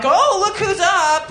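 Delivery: slow, high, mock-impressed tease; drawn-out mocking admiration. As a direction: tease him like he's a big shot. Mock greeting at a man.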